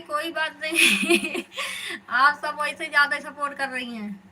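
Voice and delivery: high, loud, laughing reassurance. Real, not acted. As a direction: laugh right through the words. Laughs while reassuring and thanking didi.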